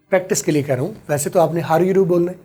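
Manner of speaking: normal talking voice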